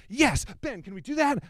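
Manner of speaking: high, eager questioning voice; eager yes man. Retold or imitated. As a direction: pester cheerfully like an overexcited helper. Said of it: "He's like".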